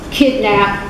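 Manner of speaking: ordinary talk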